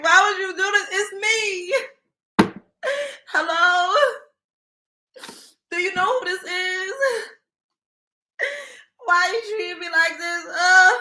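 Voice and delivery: high, loud, mock-tearful drunk pleading; drunk crying voicemail. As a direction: play the drunk caller whining into the phone. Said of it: "Be drunk like".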